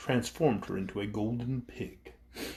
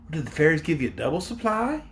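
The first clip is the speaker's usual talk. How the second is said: put-on a girl's high, eager question; young Dorothy asking; 5 semitones higher, 4 dB louder, faster than usual. A narrator's reading. Dorothy asks the Flathead chief, girlish and eager. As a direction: ask like a curious young girl. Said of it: "inquired Dorothy".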